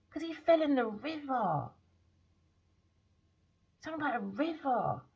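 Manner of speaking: usual speaking voice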